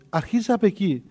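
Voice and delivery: usual speaking voice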